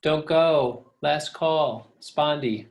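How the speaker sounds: "Don't go. Last call. Spondy." with every syllable hit; spondee, both stressed. Shown as a demonstration. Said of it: "Spondy is too stressed".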